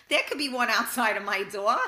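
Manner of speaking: loud, amused, breaking into laughter; laughing. Real, not acted. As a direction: joke through a real laugh. Joking hopefully about a gifted Lexus.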